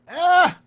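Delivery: very high, loud "Ah!" crowing at the rival; gleeful taunting shout. Real, not acted. Streamer taunting his rival online, crowing loudly. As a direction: crow it out like you caught him.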